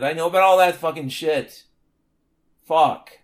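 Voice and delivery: a loud, fed-up burst; exasperated outburst. Real, not acted. Ranting host vents at buzzwords, loud exasperated outburst. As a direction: vent it, swearing at the jargon. Frustrated rant at academic jargon.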